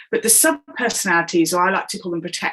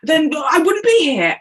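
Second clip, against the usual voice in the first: indignant outburst; 7 semitones higher, slower than usual. Retold or imitated. Coach retells a client snapping back after being sworn at, with mock indignation.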